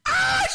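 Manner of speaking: very high shriek of pain; hammed-up piercing scream; loud scream. Wife stung a second time, loud scream. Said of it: "She screams".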